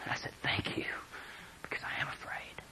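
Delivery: barely audible, reverent whisper. Retold or imitated. Jesus reassures fallen John and John answers, whispered. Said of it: "he whispered to me".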